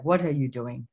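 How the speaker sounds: lightly imitated Lithuanian father; bad Lithuanian accent. Father questioning his daughter about her money, mock Lithuanian accent. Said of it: "do a really bad Lithuanian accent".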